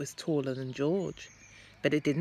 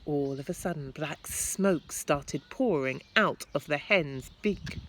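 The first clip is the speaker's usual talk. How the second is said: plain reading voice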